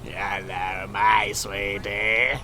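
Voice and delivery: slow, low crazy possessed voice. Pretend. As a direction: drag it out like a possessed creepy thing. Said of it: "crazy possessed voice".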